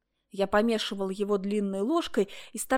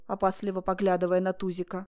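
ordinary delivery

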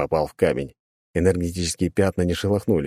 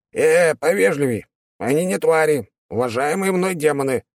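huffy imp correcting the rude man; indignant demon voice; 9 semitones higher than usual. Acted.

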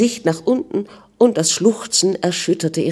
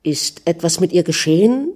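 plain reading voice

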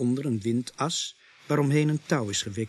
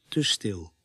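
put-on old frontiersman; 4 semitones lower than usual. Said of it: "Indianenjager antwoordt".

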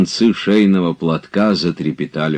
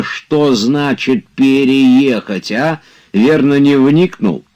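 a higher, lightly voiced indignant master; 9 semitones higher, 5 dB louder than usual. A narrator's reading. Oblomov lecturing Zakhar on what moving means, indignant. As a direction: lecture the servant with mounting indignation.

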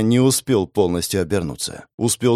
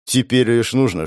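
plain reading voice

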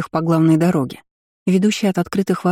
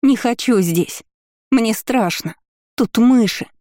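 higher, trembling whimper; frightened crying; 5 semitones higher, slower than usual. A narrator's reading. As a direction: plead in fear through tears. Weeping prisoner continues.

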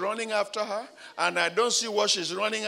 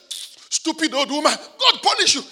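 put-on high loud cursing of the old woman; mild high mock curse; possessed girl cursing; 9 semitones higher, 10 dB louder than usual. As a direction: spit out the curse. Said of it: "He said".

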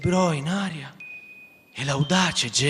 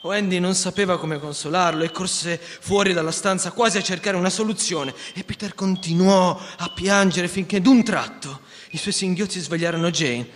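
plain reading voice

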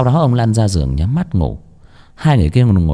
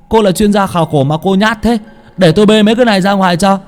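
high, brisk character voice; raised, energetic dialogue voice; teasing young companion; 11 semitones higher, 3 dB louder, faster than usual. A narrator's reading. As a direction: chide the scaredy-cat and take charge. Character teases the frightened girl, offers help.

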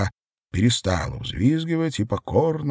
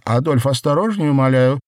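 raised, higher-pitched plea; pleading exclamation; 7 semitones higher, 7 dB louder than usual. A narrator's reading. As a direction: cry out in alarm, begging. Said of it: "воскликнула Надежда".

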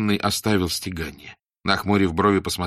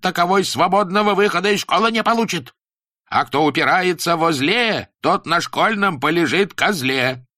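angry teacher shout; 10 semitones higher, 5 dB louder than usual. Acted. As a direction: roar at the noisy pupils. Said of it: "Кузьма Демьяныч закричал".

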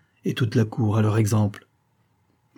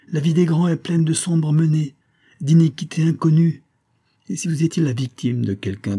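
higher, impassioned warning; impetuous; 6 semitones higher than usual. A narrator's reading. Officer warns Maria of court intrigues, impetuously. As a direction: warn her with rising force. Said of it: "s'écria impétueusement Font-Mort".

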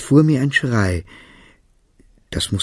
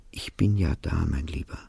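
much softer, lower, tender; comforting; 5 semitones lower, 8 dB quieter than usual. Acted. Mother crouching beside her sobbing son, soft and tender. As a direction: hush him gently.

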